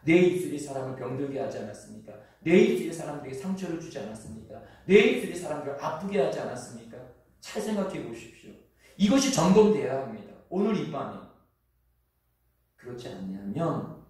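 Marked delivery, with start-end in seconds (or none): 0.0-11.2 rapid, rising, insistent sermon delivery; fervent preaching. Acted. Pastor urging the congregation to examine their lips tonight, fervent fast preaching. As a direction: press the questions on the listeners one after another.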